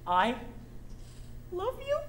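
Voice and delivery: I love you? with a high rising question tune on you; unsure, rising up at the end as if asking. Shown as a demonstration. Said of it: "you basically phrase it as a question".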